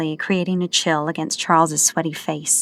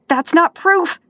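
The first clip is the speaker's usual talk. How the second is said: high, louder panicked denial; 12 semitones higher, 6 dB louder, slower than usual. Acted. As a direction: blurt it out in panic. Charles panicked.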